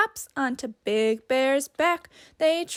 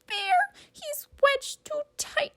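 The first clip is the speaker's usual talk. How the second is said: very high squeaky mouse voice; tiny worried mouse squeak. Acted. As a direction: squeak with pity for Bear. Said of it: "Mouse squeaks".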